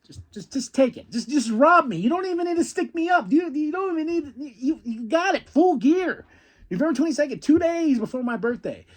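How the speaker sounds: high, fast gushing; giddy excitement. Real, not acted. Fan gushing about Full Gear, giddy and high. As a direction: beg them to take your money, giddy. Gushing over upcoming match.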